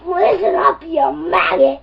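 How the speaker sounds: deep drill sergeant voice. Acted. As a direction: order the maggot around. Drill sergeant addresses recruit.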